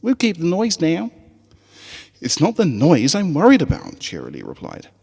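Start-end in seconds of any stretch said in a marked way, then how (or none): 0.0-1.1 much higher, Southern twang, eager; high country filly voice. Acted. Apple Bloom rallying the Crusaders on the school trip, high Southern twang. 2.2-4.0 bright, higher schoolteacher calling her class; rally the little ponies with a smile; cheerful teacher voice. Acted. Teacher Cheerilee calling her students to camp, bright and high.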